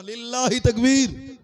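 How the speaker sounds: high, loud, drawn-out slogan; rallying cry. Shown as a demonstration. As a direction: shout it out for the crowd to answer. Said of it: "একবার বলুন না".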